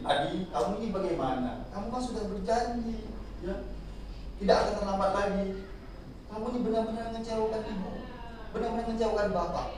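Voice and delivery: a hint of a wounded teacher, quietly reproachful; soft but hurtful. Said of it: "nadanya lembut, tapi menyakitkan hati". (nada lembut tapi menyakitkan hati)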